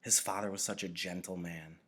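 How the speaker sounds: ordinary delivery, nothing marked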